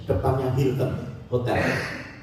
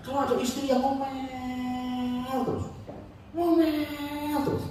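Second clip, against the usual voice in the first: mock nagging wife; 9 semitones higher, 6 dB quieter than usual.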